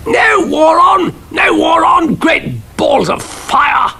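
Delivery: high, slow shouted bluster; outraged shout. A narrator's reading. Cannon told there's no war, shouting. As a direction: bellow like a scandalised colonel.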